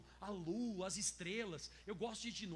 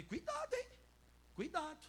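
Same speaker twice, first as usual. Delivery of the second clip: teasing warning; 9 semitones higher than usual. Retold or imitated. Pastor mock-threatens the idol.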